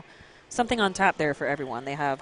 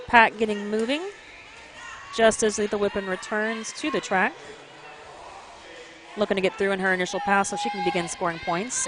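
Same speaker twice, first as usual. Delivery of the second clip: play-by-play; 2 semitones higher than usual. Real, not acted. Live derby play-by-play.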